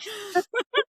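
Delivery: high bursting giggle; laughing. Real, not acted. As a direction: break into a real high laugh. Laughing at his hiding from Jesus.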